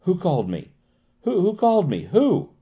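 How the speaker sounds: startled, leaping to high pitch; sharp exclamation. A narrator's reading. Patient springing up at an imagined call, sharply alarmed. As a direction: demand it sharply, climbing in alarm. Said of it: "exclaimed sharply".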